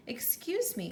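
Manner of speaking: high, polite little cricket voice; a tiny insect politely interrupting; cricket clearing its throat. Acted. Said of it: "coughed a cricket".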